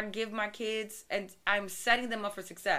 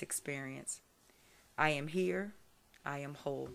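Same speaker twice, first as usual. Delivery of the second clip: meditation voice; 5 semitones lower than usual. Acted. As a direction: read it like a calm guided meditation. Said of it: "give us your good meditation voice".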